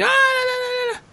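high mock scream of gibberish; imitating a panicked woman's screaming. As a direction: yelp it up high and wild. Imitating her screaming in the street.

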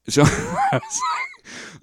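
words breaking into a high laugh; real laugh through the words. Real, not acted. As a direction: burst out laughing mid-phrase. Laughing at the heavy film.

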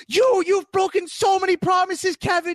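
high shouted accusation, hammed-up; mock angry shout. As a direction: yell it at him like a betrayed ally. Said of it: "[name] just going at Kevin".

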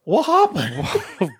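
high, drawn-out mock bewilderment; hammed-up confused reaction; baffled disbelief. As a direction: stretch it out, lost and laughing. Repeats bafflement at movie's ending.

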